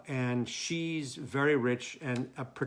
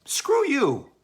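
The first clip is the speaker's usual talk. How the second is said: high, louder snapping retort; a sharp higher comeback; angry retort; 12 semitones higher, 7 dB louder than usual. Acted. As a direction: spit it back at her. Heated exchange between the lovers.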